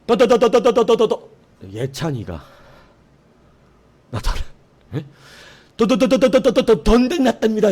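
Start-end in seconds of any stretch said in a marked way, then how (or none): 0.1-1.3 high, loud breathless stutter; can't get the words out in panic; panicked stammer. Retold or imitated. Panicked private stammering news of war to officer. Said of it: "얘가 말을 못해요". 5.8-7.7 high, loud breathless stutter; can't get the words out in panic; panicked stammer. Retold or imitated. Panicked private stammering news of war to officer. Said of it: "얘가 말을 못해요".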